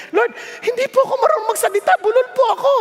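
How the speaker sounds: a new pastor pleading he stammers; pleading excuse. Retold or imitated. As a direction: rush out a nervous excuse. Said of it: "ganun ang tanong ko eh".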